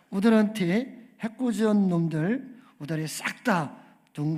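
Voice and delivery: 전라도 사투리로 신나게 읊는 주기도문; Jeolla dialect. Pretend. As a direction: pray the Lord's Prayer like a Jeolla countryman for laughs. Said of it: "전라도 버전입니다".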